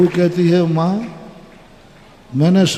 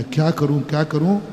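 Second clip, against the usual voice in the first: worried thinking; 5 semitones lower, 8 dB quieter than usual. Acted. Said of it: "उस माँ जी के मन में आया".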